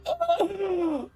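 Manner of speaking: a high, sharp gasp of shock. Retold or imitated. Office watching the captain's form get shredded, sharp gasp.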